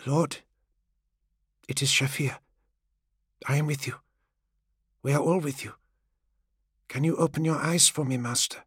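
soft, gentle servant's voice; far gentler. A narrator's reading. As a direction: softly reassure your master as he wakes. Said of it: "this new voice was far gentler".

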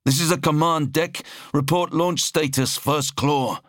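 high-pitched officer calling in; high, urgent command deck call; officer on comms. A narrator's reading. Officer calls over the vox.

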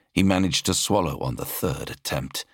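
plain reading voice

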